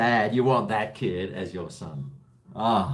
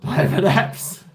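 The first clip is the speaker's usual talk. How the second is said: calling a viewer's name, high and slow; greeting a viewer by name in chat; loud drawn-out call; 9 semitones higher, 8 dB louder, slower than usual. Real, not acted. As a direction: sing out the name to greet him.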